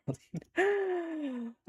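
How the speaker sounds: high squealing laugh; long squeal of laughter; real laugh. Real, not acted. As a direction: burst into a high-pitched laugh. Laughs at friend's message.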